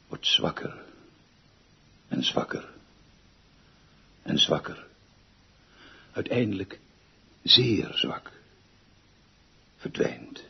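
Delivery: fading from a soft voice down to a whisper; fading away. Acted. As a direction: let the voice die out like a distant horn. Posthorn sound fading into silence.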